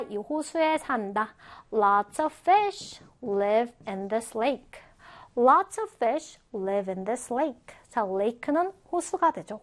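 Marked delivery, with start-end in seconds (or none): 1.7-7.8 slow, carefully spaced English example; slow model reading. Shown as a demonstration. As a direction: read it slowly for learners to follow. Teacher models example sentence for learners.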